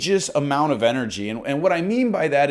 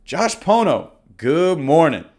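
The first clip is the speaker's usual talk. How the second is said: warm confident greeting; 3 semitones higher, 5 dB louder than usual. Shown as a demonstration. Agent pretending to call John, warm and confident.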